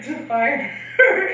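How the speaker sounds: drawn-out deadpan, laughing; slow deadpan. Retold or imitated. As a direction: stretch it out, laughing through. Teasing friend, slow deadpan.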